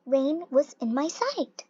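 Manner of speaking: ordinary delivery, nothing marked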